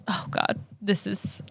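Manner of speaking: soft muttered aside. Real, not acted. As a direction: drop to a mumble. Fumbling aside.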